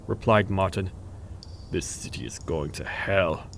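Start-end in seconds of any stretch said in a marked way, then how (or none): 1.7-3.5 slow, low, soft disgust. A narrator's reading. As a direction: say it low and slow, sick of it all. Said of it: "agreed Diego in disgust".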